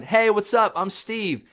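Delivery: put-on high, perky kids' host greeting; Steve. TV host opening episode one, perky imitation. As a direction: introduce yourself brightly to little viewers. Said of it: "Boom, episode one".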